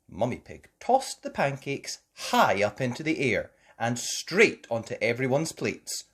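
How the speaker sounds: ordinary delivery, nothing marked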